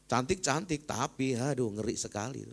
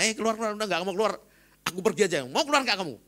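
mock exorcism command; 9 semitones higher, 5 dB louder, faster than usual. Casting out a demon.

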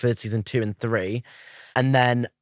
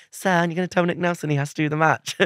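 higher, eager student line; exact audition delivery; 6 semitones higher than usual. Shown as a demonstration. Actor showing his one audition line, eager student voice. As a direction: say it like the audition take. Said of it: "I say it exactly how I say it".

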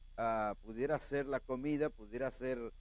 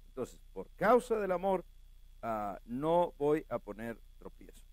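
ordinary talk, nothing marked

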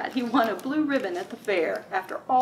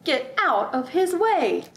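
excited warning; 5 semitones higher, 5 dB louder, slower than usual. Acted.

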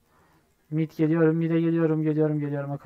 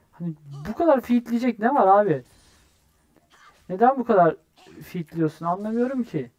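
higher, louder irritated questioning; annoyed; 5 semitones higher, 5 dB louder than usual. Real, not acted. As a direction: demand an explanation from feeding teammates. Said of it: "Şu adamlara gıcık kapıyorum". (gıcık olmuş, yükselen sesle sitem)

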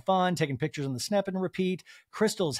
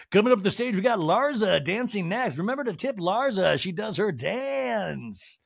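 strip club DJ patter; higher, sing-out introduction with a long last word; mock stage announcer; 5 semitones higher than usual. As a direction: call the next dancer to the stage, hammed up. Pretend stage introduction of dancer.

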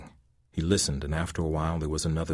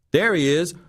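high, loud shout from below; crying out; 11 semitones higher, 6 dB louder than usual. A narrator's reading. Thug spotting the old man on the stairs, shouting. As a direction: spot him and cry out. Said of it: "a voice cried from below".

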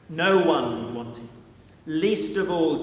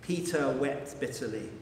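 hushed sorrow; 5 semitones lower, 9 dB quieter than usual. A narrator's reading. Narration of grief.

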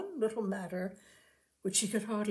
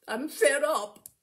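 sulky misery; 5 semitones higher, 8 dB louder, slower than usual. Acted. Rosie miserable.

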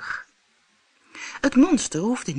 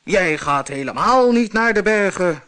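louder monster roar; 3 semitones higher, 5 dB louder than usual. Acted. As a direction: bellow like a hungry troll. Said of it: "brulde het monster".

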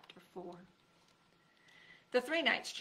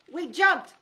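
gasping; 8 semitones higher, 12 dB louder than usual. A narrator's reading. Gasp as they jump.

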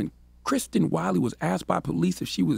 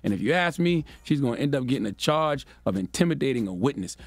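ordinary delivery

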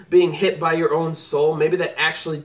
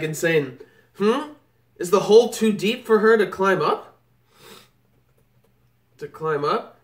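ordinary talk, nothing marked

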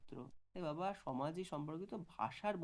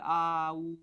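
loud, drawn-out vowel sounds; cooing demonstration; 12 dB louder than usual. Shown as a demonstration. Teacher showing students how babies coo, drawn-out demonstration. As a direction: imitate a baby's coo, held loud and level. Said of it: "vowel like sound বলা হয়".

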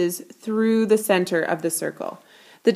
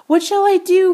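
high, loud worried question; boy alone in the workroom, worried; anxious wondering; 10 semitones higher, 6 dB louder than usual. A narrator's reading. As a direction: panic a little as you ask. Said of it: "he wondered".

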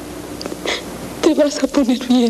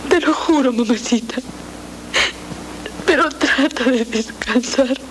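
tearful promise; 8 semitones higher than usual. Acted. Daughter promises the dying mother.

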